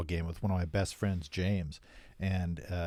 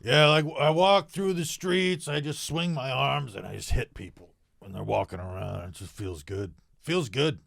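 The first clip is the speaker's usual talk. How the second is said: playing a bully; 4 semitones higher than usual. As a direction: brag like a dim street bully. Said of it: "you were supposed to be a counterpoint to my character".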